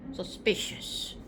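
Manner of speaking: slow, hushed aside; suspicious whisper. Acted. Aside on a secretive invitation, suspicious whisper. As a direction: whisper it slowly with mock suspicion.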